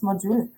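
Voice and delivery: module said the French way, mo-dule with a pure o. Shown as a demonstration. Teacher showing students the French word, French pronunciation. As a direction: say module as they do in France, mo-DULE. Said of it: "We call it module in here in France".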